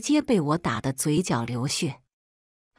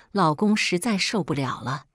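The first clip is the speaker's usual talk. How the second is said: plain reading voice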